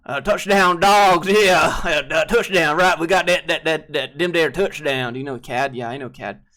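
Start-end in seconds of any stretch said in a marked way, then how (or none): 0.1-5.1 loud, higher Southern touchdown holler; Southern football fan. Pretend. Streamer playing a Georgia fan cheering a touchdown, Southern holler. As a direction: whoop it up in a thick Southern accent.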